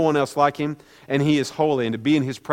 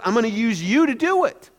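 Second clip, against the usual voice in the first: higher, offhand voice lifting at the end; casual mention; 6 semitones higher than usual. Acted. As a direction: slip it in casually, as if in passing. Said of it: "He kinda notices it casually at first".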